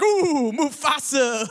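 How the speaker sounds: high, drawn-out mock shiver; hammed-up spooky hyena teasing; joking hyenas. Hyenas joking around about Mufasa, mock-spooked. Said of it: "they're joking around, they're like".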